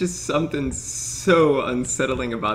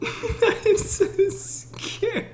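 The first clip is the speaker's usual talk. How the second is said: high, slow, laughing whimper; high drawn-out giggly fright; laughing scared whine; much slower than usual. Real, not acted. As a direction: squeak it out through nervous laughter.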